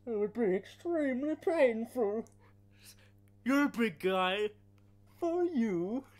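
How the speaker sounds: hammed-up Bane impression. Friend acts out Bane scene, high loud mock voice. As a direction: ham up the villain lines slowly. Quoting Bane movie lines.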